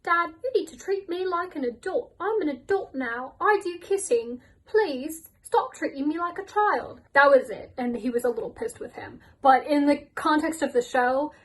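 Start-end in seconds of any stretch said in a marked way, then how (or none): 0.0-7.0 mocking, higher-pitched teen whine; lightly mocked teenager pleading with his dad; whiny teenage son. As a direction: play the sulky son demanding respect. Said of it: "Timothy was like".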